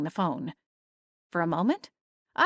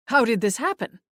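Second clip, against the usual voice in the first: thundered; 4 semitones higher, 4 dB louder than usual. Acted. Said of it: "August thundered".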